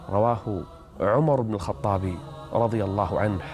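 ordinary talk, nothing marked

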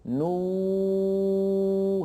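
long held "نو" on a high level note, free of nasal hum; pure vowel, no ghunna. Shown as a demonstration. Teacher isolates the sound, chanting "نو" without nasality. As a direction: sustain "نو" as a clean chanted oo. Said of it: "هذا الصوت كله ليس فيه غنة".

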